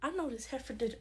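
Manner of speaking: very soft, under the breath; hushed disbelief; muttered indignation. Acted. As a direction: mutter it to yourself, affronted. Muttered disbelief, then repeated louder.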